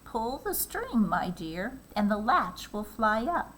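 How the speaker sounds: a hint of a frail grandmother calling to the door; old grandmother calling. A narrator's reading. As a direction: call from the bed as a sick grandmother. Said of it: "called out".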